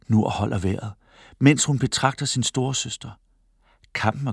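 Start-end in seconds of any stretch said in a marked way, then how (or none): none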